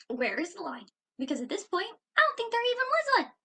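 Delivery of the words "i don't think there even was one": higher, louder and fast; high, loud, quick exasperation; indignant outburst. Real, not acted. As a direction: fire off the indignation.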